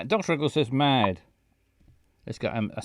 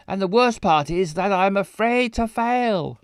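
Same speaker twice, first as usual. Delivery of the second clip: voiced character line; 6 semitones higher, 5 dB louder than usual. Reads game dialogue in character.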